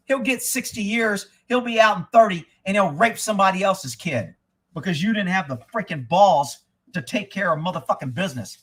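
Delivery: normal talking voice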